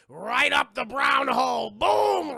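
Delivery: loud, drawn-out build to a high boom; hammed-up loud play-by-play; gleeful climactic shout. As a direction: belt out the big blow. Dramatizing the final stab.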